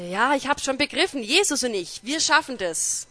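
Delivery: high and loud, pushing hard; eager insistence. Retold or imitated. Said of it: "Und sagte".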